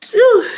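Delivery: sharp loud sneeze burst; real sneeze then a sigh. Real, not acted. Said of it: "Oh, I'm about to sneeze".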